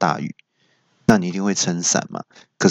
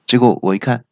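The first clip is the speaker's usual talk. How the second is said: usual speaking voice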